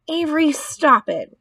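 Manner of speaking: high-pitched motherly scolding; mild cry to make a child stop. A narrator's reading. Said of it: "cried his mother".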